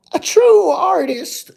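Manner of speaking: high, loud, drawn-out put-on granny; grandmother's voice. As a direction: play a grandmother in a loud falsetto. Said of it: "she used to say".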